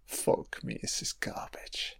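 breathy whisper under the breath; a disgusted aside, whispered. Real, not acted. Muttering at a bad TV show.

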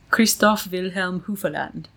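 crisp German name, every letter sounded; proudly German, syllable by syllable; German pronunciation. Shown as a demonstration. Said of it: "every letter is pronounced".

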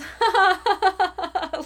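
a high, nervous fake laugh. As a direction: laugh nervously to cover the lie. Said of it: "I just did my nervous".

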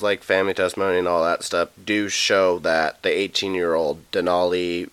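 ordinary delivery

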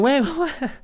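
high, loud incredulous "what?"; mock bafflement. Voicing listeners' baffled reaction.

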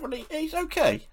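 very high, stumbling insistence; squeaky-high retold reassurance. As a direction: reassure her, voice pushed right up high. Reassuring the alarmed wife.

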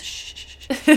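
soft unvoiced shushing; breathy soothing shh; mock hushing. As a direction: shush them like a sick child. Hushing the poisoned partner.

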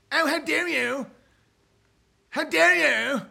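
high, hammed-up how dare you; mock outrage. Streamer feigning offence at chat, high mock outrage. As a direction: pretend to be deeply offended.

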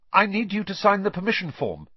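put-on a nervous boy rushing his request. A narrator's reading. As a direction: get it all out before he can refuse. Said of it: "said Harry in a rush".

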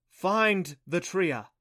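very slow, loud, high pronouncement; goddess's command. A narrator's reading. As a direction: stretch out each word of the solemn order. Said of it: "the voice said".

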